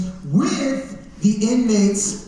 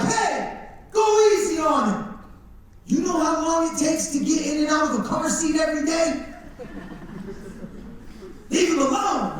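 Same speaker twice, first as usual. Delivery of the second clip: much higher, protective shouting; defending inmate; 7 semitones higher, 3 dB quieter than usual. Retold or imitated. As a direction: stand up for the teacher. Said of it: "he was like".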